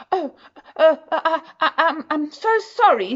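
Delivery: flustered and sorry, stumbling over every word; nervous stammer. A narrator's reading. Mister Good bumps into a stranger, stammering nervously. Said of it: "stammered Mister Good nervously".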